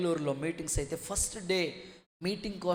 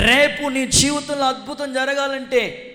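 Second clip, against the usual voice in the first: high, loud preaching cry; forceful proclamation; 9 semitones higher, 12 dB louder than usual. Real, not acted. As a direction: proclaim it to the whole congregation. (గట్టిగా ప్రకటిస్తూ)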